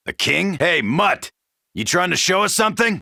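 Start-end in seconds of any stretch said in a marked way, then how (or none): none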